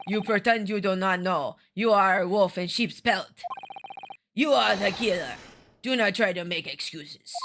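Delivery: accusing Russian sailor; deliberate words in a put-on Russian accent. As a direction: condemn him like a stern Russian sailor. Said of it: "a Russian accent, but we'll try it".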